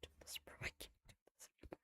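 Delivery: breathless scared whisper, barely voiced; frightened whisper. Real, not acted. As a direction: hush it like you can't bear to watch. Listener overwhelmed by the scene.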